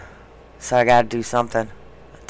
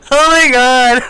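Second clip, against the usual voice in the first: high, loud fake sob; hammed-up tearful wail; mock crying; 13 semitones higher, 10 dB louder, slower than usual. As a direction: wail it like a toilet paper ad made you cry. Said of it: "I'll be like".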